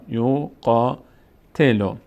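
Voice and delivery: یو قاتلو slowly, long aa on قا; slow recitation. Shown as a demonstration. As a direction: model یو قاتلو for the class. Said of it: "فتحه بعدش الف صدای آ".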